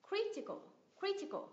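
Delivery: CRI in "Critical" pitched high, then falling away; "critical" as CRI-ti-cal, first syllable stressed. Shown as a demonstration. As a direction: hit CRI in "critical", let the rest drop. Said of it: "nhấn âm tiết từ ba từ cuối trở lên".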